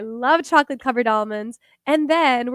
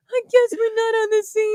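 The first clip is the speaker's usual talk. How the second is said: high, sulky little voice; mock hurt; 8 semitones higher than usual. Woman joins boyfriend's food-sharing act, mock hurt. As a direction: play along as the wounded one.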